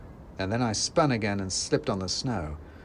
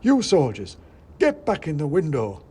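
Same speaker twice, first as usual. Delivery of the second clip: louder, higher little shopkeeper scolding; angry shopkeeper; 6 semitones higher, 7 dB louder than usual. A narrator's reading. Red-faced shopkeeper ordering his soldiers back, loud. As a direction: scold like a flustered tiny man. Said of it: "quite red in the face".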